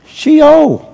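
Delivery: put-on high, punched "she old"; comic high-voiced excuse; high-pitched blurt. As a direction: blurt it high, stretching the last word. Retells blurting blunt excuse to God.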